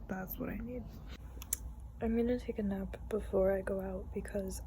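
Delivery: soft, breathless whispering to the camera; hushed whisper, as if someone nearby is asleep. Real, not acted. Whispers to camera while tired.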